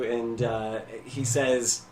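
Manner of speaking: usual speaking voice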